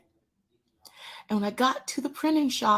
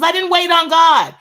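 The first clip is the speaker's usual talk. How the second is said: emphatic; 8 semitones higher, 10 dB louder than usual. Real, not acted. Lesson's punchline.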